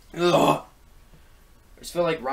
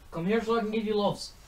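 pet talk; 6 semitones higher, 4 dB quieter than usual. Real, not acted. Vlogger coaxing her cat to come for cuddles, higher and softer. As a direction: coax the cat sweetly, a touch softer.

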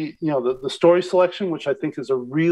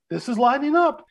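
higher-pitched mock excitement; imitated eager host; 8 semitones higher than usual. Guest mimics host on dossier correlations, mock eager. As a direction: mimic a host hopeful it fits. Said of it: "she's like".

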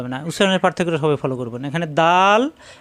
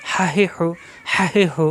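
হা, হি, হু in a quick run of throaty h syllables; quick vowels; slower than usual. Shown as a demonstration. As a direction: run হা, হি, হু together with short vowels. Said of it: "হরকতের উচ্চারণ তাড়াতাড়ি". (তাড়াতাড়ি গলা থেকে হা, হি, হু)

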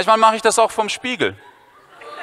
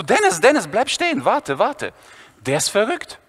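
urgent Viktor; 3 semitones higher than usual. Retold or imitated. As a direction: call him back in alarm. Viktor calls to Dennis.

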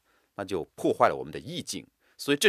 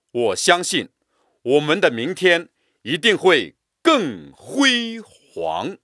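更辉 煌 stretched with a break before 煌; drawn out, broken stress; 7 semitones higher, 6 dB louder, slower than usual. Shown as a demonstration. Teacher reciting, stretching and breaking 辉煌. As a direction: hold 辉 long, pause, then 煌. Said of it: "声音拉长、断开".

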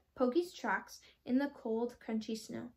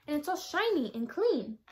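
a touch higher, still delighted; happy; 4 semitones higher, 4 dB louder than usual. Acted. Puppy admiring his cleaned boot, lightly delighted. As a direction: keep the joy going. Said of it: "he cried happily".

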